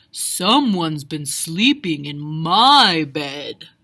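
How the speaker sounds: low, loud, slow bear growl; deep growling father bear; Papa Bear growling. A narrator's reading. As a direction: growl it like a big suspicious bear. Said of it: "growled Papa Bear".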